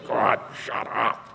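slow, voiceless seething aside; hushed, drawn-out mock fury; pissed off. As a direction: seethe it under the breath. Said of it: "I'm sitting there just pissed".

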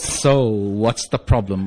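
low, drawn-out counselor voice. Retold or imitated. Counselor repeats himself to the husband, slow and low. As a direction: ask it very slowly and low.

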